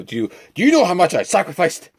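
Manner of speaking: pretending to yell back at his boss in Osborn's voice; mock Osborn outburst. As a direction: stand up and fling it at the boss. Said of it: "little person wants to do".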